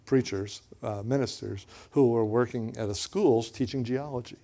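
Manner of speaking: ordinary talk, nothing marked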